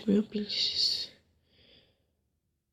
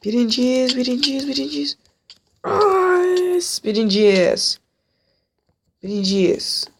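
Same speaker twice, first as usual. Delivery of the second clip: rising, louder victory cheer; excited cheer; 5 semitones higher, 5 dB louder, slower than usual. Real, not acted. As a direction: celebrate the win. Winning the match.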